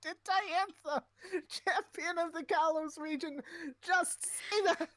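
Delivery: hammed-up incredulous squeal; mock outrage. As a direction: gasp it like a shocked gossip.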